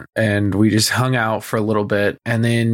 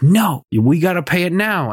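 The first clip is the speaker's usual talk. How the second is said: higher, mock-insistent ex-wife voice; retold scene-making; 7 semitones higher, 3 dB louder than usual. Ex-wife making a scene at the vet, higher mock outburst. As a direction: imitate her indignant demand, pitched up. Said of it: "starts to make a whole ass fucking scene".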